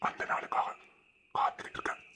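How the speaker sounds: hushed tender whisper. Acted. He whispers back to his beloved, tenderly. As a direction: say it as a secret to her.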